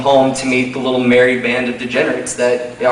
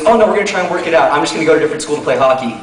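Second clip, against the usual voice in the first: fast reassuring friend; much faster than usual. Retold or imitated. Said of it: "he goes".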